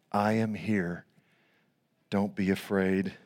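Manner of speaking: low, hushed, soothing voice; calm them softly, phrase by phrase; soft reassurance. Acted. Jesus to all in a storm, soft comfort. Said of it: "he's saying those words to all".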